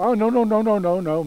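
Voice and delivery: put-on high, loud child's objection; pleading protest. Susie told she already had some, pleading child protest. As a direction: rattle off the refusals like a desperate kid.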